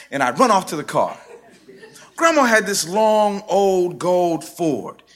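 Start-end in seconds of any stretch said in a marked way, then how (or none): none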